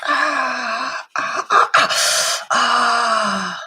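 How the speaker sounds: sharp high yelps of pain. Real, not acted. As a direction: yelp as claws dig in. Kitten climbing up her legs.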